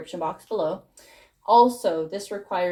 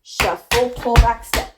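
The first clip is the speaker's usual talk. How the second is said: louder step calls over the music; called-out steps; 3 semitones higher, 6 dB louder, slower than usual. Shown as a demonstration. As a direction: call the steps out while dancing. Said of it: "So faster".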